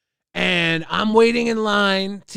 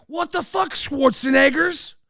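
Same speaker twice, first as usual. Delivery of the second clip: 1 incredulous high yell; 7 semitones higher than usual. Retold or imitated. Confronting the gym bros.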